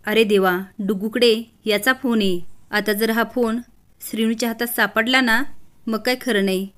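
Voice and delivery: ordinary delivery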